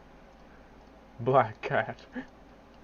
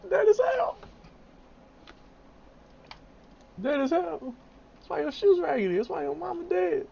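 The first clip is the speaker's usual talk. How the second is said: much higher, squeaky meme voice; 14 semitones higher than usual. Pretend. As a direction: squeak it out like a cartoon. Repeats meme line in voice.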